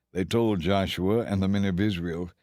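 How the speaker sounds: ordinary delivery, nothing marked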